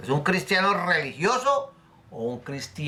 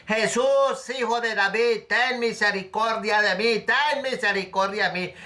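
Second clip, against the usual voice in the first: crying out for mercy; 5 semitones higher than usual. Retold or imitated. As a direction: call out desperately to be heard over the crowd. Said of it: "empezó a gritar".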